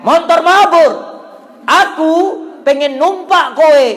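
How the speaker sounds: high, very loud call to the sky; shouting. Retold or imitated. Boy yells to the plane he longs to ride, high loud shout. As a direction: yell it out with separate, stretched phrases. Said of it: "Saya teriak".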